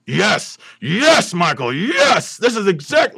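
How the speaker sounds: elated Pacino-style shout; pumped Pacino. Retold or imitated. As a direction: cheer like a thrilled movie star. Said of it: "he's just, like, immediately like".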